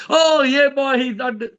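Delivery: high, loud burst of glee; mock relief. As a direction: cheer like the relieved family. Family relieved, cheers again.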